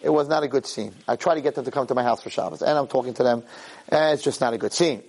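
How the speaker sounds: ordinary talk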